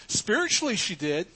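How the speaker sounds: sharp, high and loud Spiritually; a sudden loud high Spiritually; emphatic exclamation. Real, not acted. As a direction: fire off the word like an alarm. Preacher's emphatic answer.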